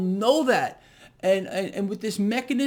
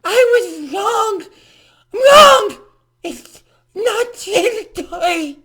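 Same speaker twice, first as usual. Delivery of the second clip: much higher and far louder, wailing mock sob, slow; mock tearful confession; 13 semitones higher, 13 dB louder, slower than usual. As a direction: parody a sobbing official admitting fault. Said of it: "shocking admission that Janet Yellen".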